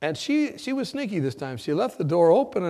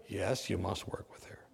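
whispered order; 9 semitones lower, 13 dB quieter than usual. Retold or imitated. Charge nurse's firm answer.

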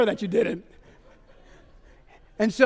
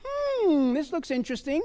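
intrigued musing; 5 semitones higher, slower than usual. Retold or imitated. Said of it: "And I'm like".

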